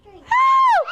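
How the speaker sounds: high, drawn shriek; shriek on request. Shown as a demonstration. Teacher retelling the beach story, high shriek. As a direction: give the class a high-pitched mock shriek. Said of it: "Shriek for me".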